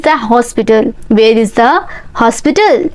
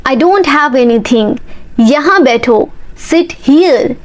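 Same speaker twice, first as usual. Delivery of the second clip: ordinary talk, nothing marked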